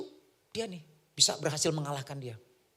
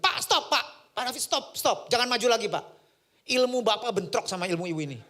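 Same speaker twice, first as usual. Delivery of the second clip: urgent warning; 5 semitones higher, 5 dB louder than usual. Retold or imitated. Aide warns him urgently.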